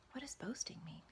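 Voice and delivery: whispered aside. Acted. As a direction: murmur the question to yourself. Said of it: "aside whisper".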